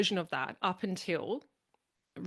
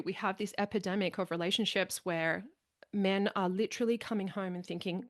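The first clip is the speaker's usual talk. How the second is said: normal talking voice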